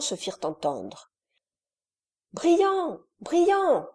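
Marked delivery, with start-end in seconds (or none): 2.3-3.9 put-on high little boy's call, louder; a nine-year-old calling out in fright; child crying out. A narrator's reading. Nine-year-old calls Briand from the hatch, high frightened cry. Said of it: "s'écria un enfant de neuf ans".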